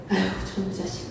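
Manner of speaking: low, soft, quick grumble; muttered grumble. Retold or imitated. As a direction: mutter at the wayward son under your breath. Parent grumbling at child.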